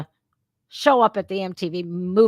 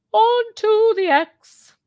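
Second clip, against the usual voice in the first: high, slow, louder mocking squeal on "Onto the X"; 13 semitones higher, 6 dB louder, slower than usual. Shown as a demonstration. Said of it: "repeated mock".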